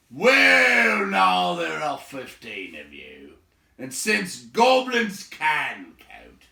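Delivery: loud, slow, high triumphant declaration; loud gruff triumph; booming host. Acted. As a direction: announce it with gruff satisfaction. Baron counting triumphantly.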